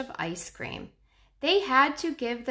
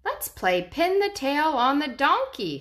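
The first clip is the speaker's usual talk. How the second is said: put-on child voice; 7 semitones higher, 4 dB louder than usual. Danny suggests a game.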